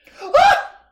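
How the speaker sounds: high shocked gasp; loud gasp. Real, not acted. Hearing a friend's reveal, with a loud delighted gasp.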